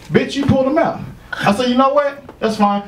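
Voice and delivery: high-pitched retold irritation; indignant comeback. Comic retelling of dismissing a woman mid-argument, indignant.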